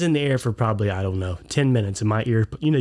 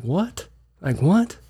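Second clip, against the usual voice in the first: retold surprise; 6 semitones higher, slower than usual. Kids report yelling man.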